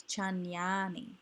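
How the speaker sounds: "Chanyani" with a long, drawn-out "ya"; lengthened middle syllable. Shown as a demonstration. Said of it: "so it can also be lengthened".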